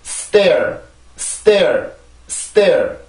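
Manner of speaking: "stair" drawn out with a falling tone; model pronunciation. Shown as a demonstration. English teacher models a word for learners to repeat, model pronunciation. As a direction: say "stair" long, gliding down into the r. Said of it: "그렇게 해서 요 단어를 발음을 해주세요".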